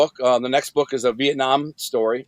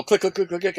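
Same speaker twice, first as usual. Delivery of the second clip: rapid little string of clicks, higher pitched; quick door-click imitation, tailing off softer; imitated clicking; 4 semitones higher, 4 dB quieter than usual. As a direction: mimic cell doors clicking open one after another. Imitating the doors unlocking.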